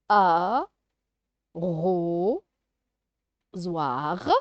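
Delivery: "arrosoir" very slow, a, ro, soir with long pauses; syllable by syllable. Shown as a demonstration. Teacher sounding out syllables for a child, "arrosoir" very slowly. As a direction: widely separate the beats of "arrosoir". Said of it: "de faire les syllabes".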